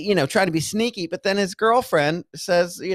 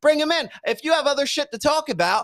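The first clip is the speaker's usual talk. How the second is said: higher-pitched, insistent argument; exasperated; 7 semitones higher than usual. Real, not acted. Arguing over bringing the guest on, exasperated. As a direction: press the point, fed up.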